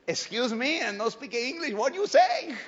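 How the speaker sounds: put-on Spanish-accented broken English, rising in disbelief; preacher feigns disbelief in Spanish-accented broken English; mock broken English. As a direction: play a stunned newcomer asking again in Spanish-accented English.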